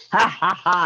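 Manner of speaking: low, measured fake evil chuckle; mock villain laugh. Mock gloating at caught student.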